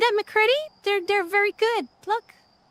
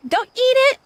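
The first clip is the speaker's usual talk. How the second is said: high, loud warning to a dog; urgent yelp of a command; sudden scolding shout; 8 semitones higher, 8 dB louder than usual. Real, not acted. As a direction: shout to stop the dog at once.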